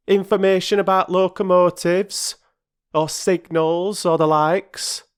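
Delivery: high, loud mocking porter; sarcastic porter. Perks mocking the children, sarcastic. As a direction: needle them with sarcasm.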